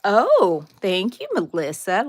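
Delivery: ordinary talk, nothing marked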